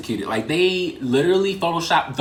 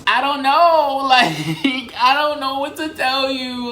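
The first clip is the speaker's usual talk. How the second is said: laughing helplessly; 10 semitones higher, 4 dB louder, slower than usual. Real, not acted. Laughing over the embarrassment.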